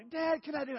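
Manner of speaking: put-on high, loud begging child; pleading kid voice. Boy begging his dad to let him jump, high and loud. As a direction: beg Dad like an eager boy.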